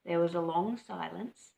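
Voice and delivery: plain reading voice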